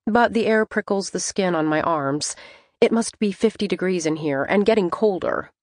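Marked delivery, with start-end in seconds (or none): none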